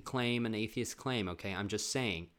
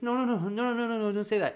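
high, loud frantic no's; panicked denial; 10 semitones higher, 5 dB louder, faster than usual. Retold or imitated. Mom to her kids, frantic high denial. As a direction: refuse to believe it, voice climbing.

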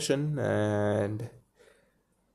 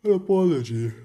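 mock apology; 9 semitones higher, 6 dB louder than usual. Mock reading of Peter's apology.

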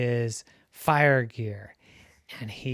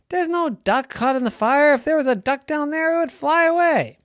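high, loud dismissive protest; insistent parents; 13 semitones higher, 8 dB louder than usual. A narrator's reading. Parents dismiss Ducky's worry about a trapped duck, insistent. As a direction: overrule the worry, pitched way up.

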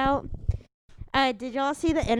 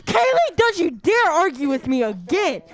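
angry mom; 3 semitones higher, 5 dB louder than usual. Acted. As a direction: scold like a furious mom. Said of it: "impression of my mom".

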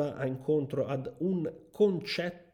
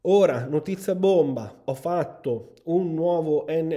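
excited announcement; 3 semitones higher, 8 dB louder than usual. Real, not acted. Announcing big news.

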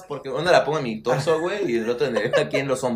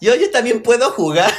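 put-on high kid voice through laughter; laughing little boy wanting in; a hint of a child, laughing; childish voice; 8 semitones higher, 4 dB louder than usual. Continues the child voice.

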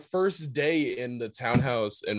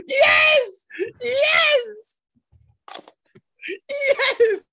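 much higher, loud whooping yes; ecstatic cheer; 7 dB louder, much slower than usual. Real, not acted. Cohost cheering at absurd story, ecstatic shouts. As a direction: cheer it like a winning goal.

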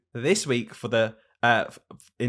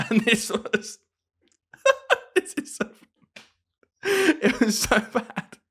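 laughing; 12 semitones higher, slower than usual. Real, not acted. Laughing while setting up joke.

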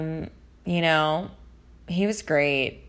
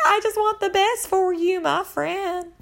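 much higher, imitating a tearful breakdown; mock tearful; 12 semitones higher than usual. Imitating Raven crying to Tia, mock tearful plea. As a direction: play a friend's teary plea, half-hearted. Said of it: "Raven cries and she, like, breaks down".

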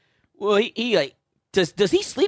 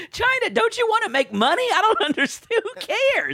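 very high, incredulous squeal of protest; exasperated mock plea. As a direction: plead in a comically high voice, half laughing.

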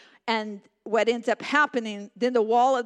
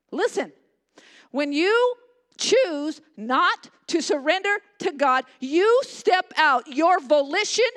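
higher, louder, slow preaching; 5 semitones higher, 4 dB louder, slower than usual. Acted. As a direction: preach with rising force. Preaching at full force.

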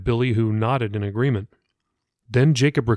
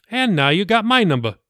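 smirking pastor; 8 semitones higher, 3 dB louder than usual. Acted. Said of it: "Billy said, smirking".

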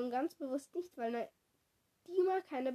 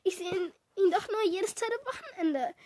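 higher-pitched complaint, building; whining; 5 semitones higher, 4 dB louder than usual. A narrator's reading. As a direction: whine in self-pity. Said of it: "jammerte Kara". (jammernd, mit gehobener Stimme)

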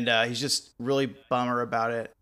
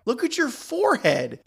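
much higher, louder, hammed-up yelling at a wrestler; mock scolding yell; 11 semitones higher, 5 dB louder than usual. Referee yelling at Abdullah to drop the fork, hammed-up shout. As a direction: bellow at him like a fed-up referee.

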